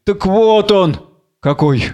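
plain reading voice

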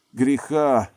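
loud, high scoffing echo; wincing scorn. A narrator's reading. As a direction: wince at the word with disdain. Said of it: "поморщилась Мерцалова".

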